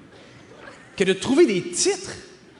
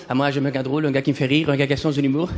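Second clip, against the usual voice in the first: much lower put-on voice; mock fangirl; 9 semitones lower, faster than usual. Girls saying they like funny guys, mock put-on low voice. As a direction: imitate a girl in a mock low voice. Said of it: "Les filles vont dire".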